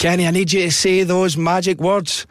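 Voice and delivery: high, projected DJ prompt; excited radio presenter. Retold or imitated. Presenter prompting Kenny for catchphrase, excited. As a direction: egg the winner on like a game host.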